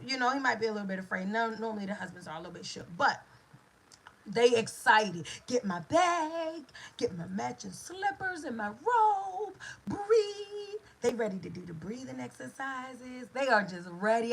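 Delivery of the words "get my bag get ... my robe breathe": higher, a hint of a panicky dad-to-be; mock frantic orders to grab the bag; flustered husband. Husband fussing about the bag, mildly flustered and higher. Excited husband rushing at labor start.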